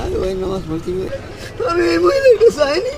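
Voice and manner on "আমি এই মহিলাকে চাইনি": high, loud sobbing plea; real tears, voice breaking high; crying. Real, not acted. Old man weeping in prayer.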